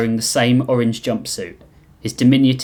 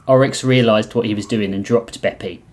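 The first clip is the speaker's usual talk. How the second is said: usual speaking voice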